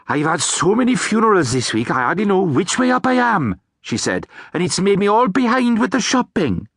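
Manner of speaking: a flustered neighbour run off her feet; banshee neighbour voice. Acted. Banshee neighbour borrowing sugar from the hag, flustered chatter.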